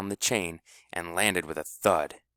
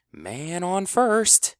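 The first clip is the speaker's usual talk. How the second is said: high, slow sing-song announcement; bright high call, stretched slow; chirpy call; 10 semitones higher, 3 dB louder, much slower than usual. A narrator's reading. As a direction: chirp it out, drawing it long. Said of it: "chirped Finesse".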